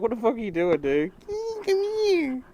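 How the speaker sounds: high, loud annoyed cat voice; voicing the cat. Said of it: "The cat's like".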